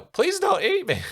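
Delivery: very high, loud panicked squeal; mock terrified shriek; mock terrified yell. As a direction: shriek it like a hammed-up coward. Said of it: "I'd yell".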